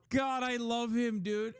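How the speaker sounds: high, thrilled outburst; excited. Real, not acted. Streamer thrilled by the comeback, excited outburst. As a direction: gush with delight.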